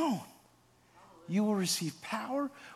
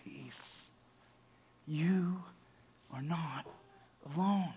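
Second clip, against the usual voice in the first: soft comfort; 3 semitones lower, 10 dB quieter, slower than usual. Real, not acted. Preacher speaks comfort softly.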